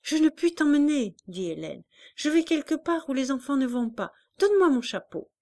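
a touch of a stern mother; firm mother. A narrator's reading. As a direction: cut the child off, firmly. Hélène refusing firmly.

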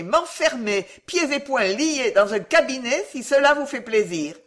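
urgent pleading. A narrator's reading. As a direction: beg a frightened crowd to listen. Pickwick begs frightened women to listen.

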